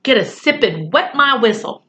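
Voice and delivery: ordinary delivery, nothing marked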